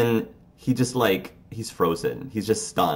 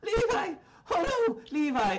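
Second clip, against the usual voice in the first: much higher, softer anguished cry of a name; distraught; 7 dB quieter than usual. Acted. Chance cries over wounded Levi, high and distraught. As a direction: wail his name in despair. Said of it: "upset and very distraught".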